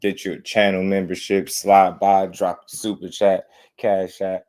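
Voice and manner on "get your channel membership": normal talking voice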